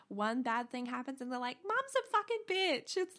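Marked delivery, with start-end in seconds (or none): 1.6-3.2 put-on much higher, louder snotty teen voice; mock the ungrateful kids, whiny and nasty; bratty kid. Kids turning on their mum.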